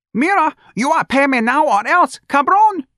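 put-on very high, loud accented threat; angry accented demand. Demanding payment, very high and loud, accented. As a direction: spit it out as a shrill demand for money.

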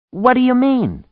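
very high, loud question; high-pitched, incredulous yell; baffled shout. A narrator's reading. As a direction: yell it back in disbelief. Baffled reply shouted over storm.

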